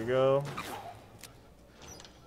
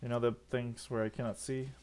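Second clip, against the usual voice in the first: normal talking voice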